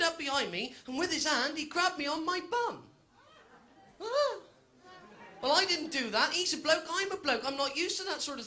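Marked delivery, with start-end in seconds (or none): 4.0-4.4 high startled squeak; comic high yelp of shock. Acted. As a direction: jump at the grab. Grabbed on the bum.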